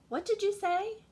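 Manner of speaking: a small cricket, high and puzzled; bright high little cricket asking. Acted. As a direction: squeak it up like a cricket that can't hear.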